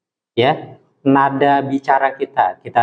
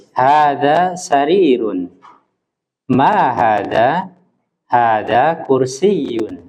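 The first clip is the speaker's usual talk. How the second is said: slow, statement falling, question rising; slow teacher model of question and answer; teacher modelling the exchange; model dialogue; much slower than usual. Shown as a demonstration. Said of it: "menyesuaikan ungkapannya".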